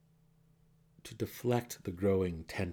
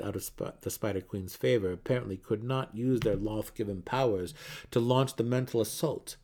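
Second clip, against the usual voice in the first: ordinary delivery, nothing marked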